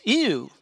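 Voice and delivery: put-on shrill high loud girlish "Ew!" shriek. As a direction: squeal in disgust and run. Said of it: "and shrieks".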